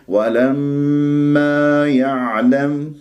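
ولما stretched with two beats on the alif; natural two-beat madd. Shown as a demonstration. Teacher showing the correct recitation. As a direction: draw out the long a of ولما, slowly. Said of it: "هكذا بهذه الطريقة".

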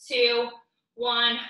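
ordinary talk

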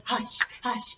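breathy whisper; whispered hush. Real, not acted. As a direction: hush them like at bedtime. Hushing the kids.

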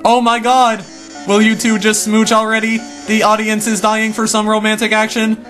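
excited heckling. Acted. Heckler urging couple to kiss.

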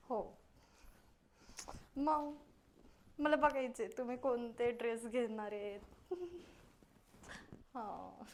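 coy and hushed, holding back giggles; shy soft phone talk. Acted. Bride whispering sweetly to her fiancé on the phone, shy and soft.

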